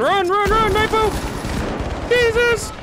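excited high yelling callouts; being chased. Real, not acted. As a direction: shout warnings, voice up high. Callouts during firefight, yelling.

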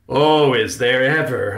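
projected, eager confirmation; excited answer. A narrator's reading. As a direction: answer with big theatrical enthusiasm. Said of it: "Uncle Riley answered excitedly".

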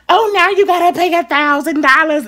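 high mock store clerk voice; a sing-song teasing clerk; mocking salesperson. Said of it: "'cause they say".

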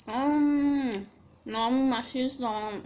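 muffled by food; chewing while talking. Real, not acted. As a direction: mouth partly full. Seen 1: Fried chicken held at mouth; cheeks working, chewing while speaking.

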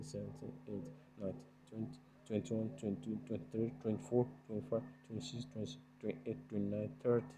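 soft counting under the breath; counting seconds. Real, not acted. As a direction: count the seconds quietly to yourself. Counting seconds while heating RAM.

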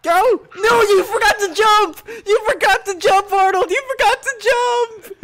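high, loud repeated yell; exasperated yelling. Real, not acted. Player scolding Arnold for not jumping, exasperated yell. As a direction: yell at your teammate in high-pitched dismay. Yells at teammate who missed jump.

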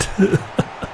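breathy laugh. Real, not acted. Laugh after bad shot.

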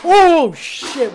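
sudden loud startled cry; startled yelp. Real, not acted. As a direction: jump at the scare and yelp. Jump scare.

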